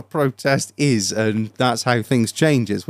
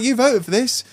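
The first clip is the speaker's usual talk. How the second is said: high, scornful retort; mock retort; 10 semitones higher than usual. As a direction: throw it back at them. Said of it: "I'm like".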